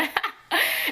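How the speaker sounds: short high-pitched laugh; real laugh. Real, not acted. Storyteller amused by the phone snooping, short high laugh. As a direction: burst out laughing briefly.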